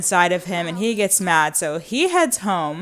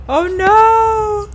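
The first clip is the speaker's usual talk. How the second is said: much higher, louder cry of alarm; high loud alarmed wail; 13 semitones higher, 8 dB louder than usual. Real, not acted. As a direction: shriek it as it all goes wrong. Sim in trouble, rising alarm.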